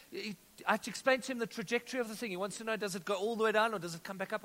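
normal talking voice

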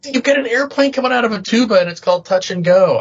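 high, incredulous disbelief; can't believe what you see. Real, not acted. Incredulous about album cover.

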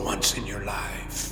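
whispering. Acted. Ghost commands daughter.

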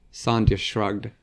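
plain reading voice

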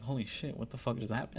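hushed, stunned murmur; retold disbelief. Son recalling the moment after the blood, hushed disbelief. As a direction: replay the shock softly, under your breath. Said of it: "like, disbelief".

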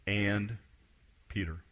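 "Peter" said very soft, falling, set apart; hushed emphasis. Acted. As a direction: drop to a near hush on "And Peter". Pastor repeats key phrase softly.

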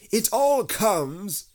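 high, loud, slow grumble from a bear; cross. A narrator's reading. Stuck Pooh blames Rabbit, cross. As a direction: complain crossly, high and drawn out. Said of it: "said Pooh crossly".

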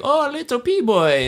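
high, drawn-out playful chef impression; imitating hibachi chef. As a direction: coo it slowly in a put-on high voice. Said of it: "About when he's like".

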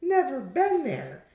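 high-pitched dismissive woman; high, disdainful retort; scornful Gladys. Acted. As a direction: brush it off in a high character voice. Scornful Gladys answers in story.

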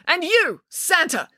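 high, sharp accusing call; high-pitched, pointed call; calling over someone. A narrator's reading. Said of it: "called over the elf's shoulder".

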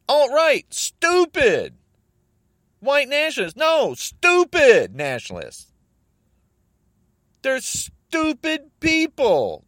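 high, loud, slow furious hammering of each word; angry rant. Real, not acted. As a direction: spit out every label in real rage. Furious rant against Trump supporters.

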